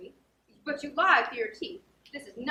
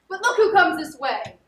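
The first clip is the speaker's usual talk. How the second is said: excited; 4 semitones higher, 10 dB louder than usual. Acted. Spotting Sir Andrew approaching.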